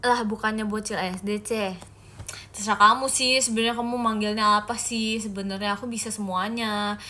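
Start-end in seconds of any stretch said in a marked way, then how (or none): none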